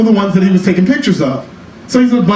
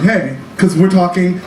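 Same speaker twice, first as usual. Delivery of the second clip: ordinary talk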